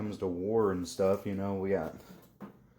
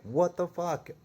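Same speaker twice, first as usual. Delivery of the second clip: shocked disbelief; 10 semitones higher than usual. Retold or imitated. Kicked out of the shop, a stunned high outcry. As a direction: blurt it out in total shock. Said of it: "And I was like".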